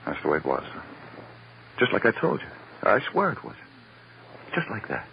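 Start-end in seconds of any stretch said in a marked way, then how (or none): 1.8-5.1 frantic insistence. Acted. Suspect frantic after confessing.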